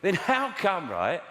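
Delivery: high, mocking challenge with swooping pitch; heckler put-down. Dad challenges his daughter's claim, mocking heckler put-down. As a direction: take the child on like a heckler. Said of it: "treating the kids like hecklers".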